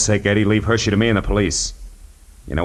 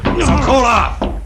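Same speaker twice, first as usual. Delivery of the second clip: high, slow, loud order; forceful command; 3 dB louder, much slower than usual. Acted. Detective pinning the pilot down, slow loud command. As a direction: bark it to end the fight.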